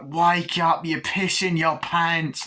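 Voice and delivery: put-on taunting voice; mock wake-up call. Man waking the sleeper, slow loud taunt.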